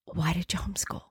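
barely audible whisper; still small voice. Retold or imitated. God cutting through her excuses, in a whisper. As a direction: repeat the question in a hushed whisper. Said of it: "That still small voice repeated that question".

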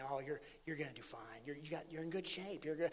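very soft, low imitation of reassuring staff; hushed, low-pitched mock reassurance; murmured reassurance. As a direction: retell it under your breath, well below normal volume.

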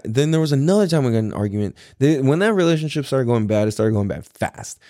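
ordinary delivery

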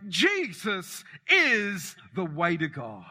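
slow and emphatic, leaning hard on "is". Real, not acted. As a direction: hammer home "is", each word weighed.

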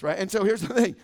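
usual speaking voice

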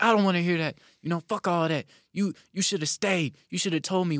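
mock Jen chewing him out; imitated scolding.

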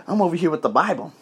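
usual speaking voice

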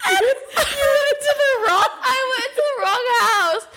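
very high, laugh-crying squeal; helpless laughter. Real, not acted. Confessing wrong house to friend, high tearful laughter. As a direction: force the words out through real giggles. Cracking up at her own mistake.

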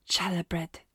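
breathy whispered musing; half-voiced, lost in thought; murmuring a menu idea aloud; whispered to herself. A narrator's reading. Cook muttering menu to herself.

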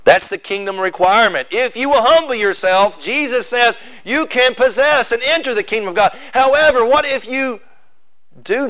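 high-pitched, pressing pulpit delivery; fervent preaching. Acted. Preacher telling the congregation the kingdom requirement, fervent preaching. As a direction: drive the promise home with rising force.

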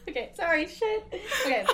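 trying not to laugh. Real, not acted.

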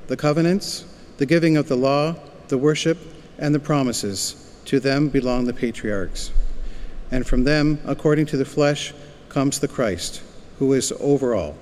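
ordinary talk

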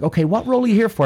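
high, quick receptionist voice. Retold or imitated. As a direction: imitate a receptionist asking briskly. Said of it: "So she's like".

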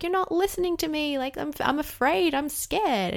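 put-on high, pleading child's voice; scared little kid. Frightened child pleading with a parent who won't listen, high and upset. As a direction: play a frightened three-year-old begging to be heard.

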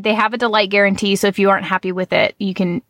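ordinary talk, nothing marked

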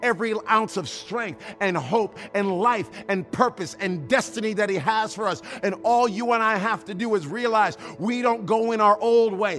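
loud sung preaching cadence; full-force preaching. Acted. Preacher to the congregation over music, full-force sung preaching. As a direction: preach it out, rising and chanting.

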